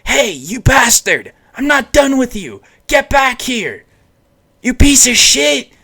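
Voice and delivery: put-on high, angry kid's yell; acted scream, pitched well up; Naruto screaming. A narrator's reading. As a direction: yell after someone walking away, high and furious. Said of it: "Naruto screaming at him".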